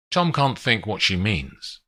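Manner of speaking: plain reading voice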